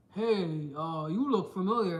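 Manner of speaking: a hint of a guy trying his luck; mock pickup line. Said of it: "a man with big belly".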